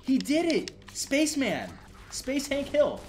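high, loud excited cheer. Real, not acted. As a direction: shout it out in triumph. Celebrating in-game donation, cheering.

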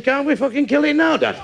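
a hint of a small boy pleading; little lad. Lad pleads with his dad, lightly voiced child. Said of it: "Little lad says to his dad".

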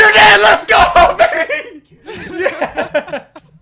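high screaming celebration breaking into laughter; ecstatic shout. Real, not acted. Player winning the game, ecstatic shout. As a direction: scream it in triumph, laughing by the end.

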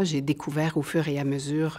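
ordinary talk

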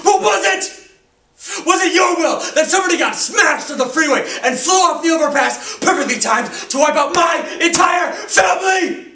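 high, loud, explosive rage; furious shout. Acted. Bereaved teenager accusing God of the crash, furious shout.

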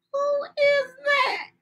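very high, surprised call; startled question. Acted. Girl spotting someone in the garden, high startled call. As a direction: leap up high in alarm.

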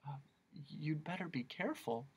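soft unvoiced warning; whispered warning. Milo warns the old lady about the witch, whispering. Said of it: "Milo advised".

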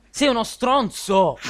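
loud, slow furious insult; angry yell. Real, not acted. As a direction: bellow it at him. Furious at friend's blunder. (urlo furioso)

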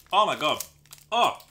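loud startled gasp at the sourness; real shock, sudden and loud; shocked by sour taste. Real, not acted. First bite of super sour candy.

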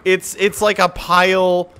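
angry rant. Real, not acted. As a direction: vent at the broken jump. Raging at jump mechanic.

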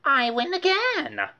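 high, a touch smug; a hint of gloating; Francine gloating. A narrator's reading. Francine gloating over the dreidel game. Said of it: "said Francine".

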